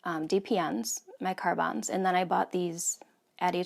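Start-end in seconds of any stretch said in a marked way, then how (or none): none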